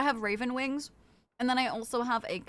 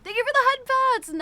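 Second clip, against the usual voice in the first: excited; 13 semitones higher, 8 dB louder than usual. Real, not acted. Thanking a viewer.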